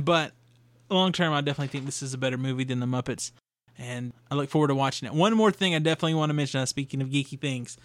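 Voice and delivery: normal talking voice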